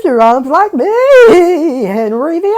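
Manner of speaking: high, loud, slow octopus voice with a laugh; Henry the Octopus. Pretend. Host finally does his octopus impression. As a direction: ham up a cartoon octopus. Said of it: "do a Henry the Octopus impression".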